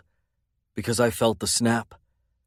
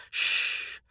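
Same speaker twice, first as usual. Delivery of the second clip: soft soothing shush; whispered shush to calm her; 7 dB quieter than usual. A narrator's reading. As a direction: hush her gently under your breath. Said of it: "I whispered".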